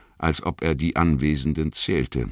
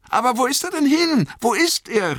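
very high, louder hobbit cry; 5 dB louder than usual. A narrator's reading. Pippin asks where Frodo has gone, crying out. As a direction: call out high and anxious. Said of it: "rief Pippin".

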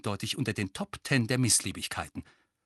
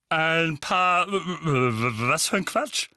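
sputtering anger; 6 semitones higher, 6 dB louder, slower than usual. A narrator's reading. Vernon sputtering angrily.

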